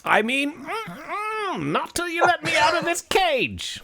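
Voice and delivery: high-pitched leprechaun demand; hammed-up high protest from the cage; stubborn leprechaun.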